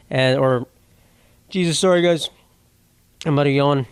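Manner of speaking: usual speaking voice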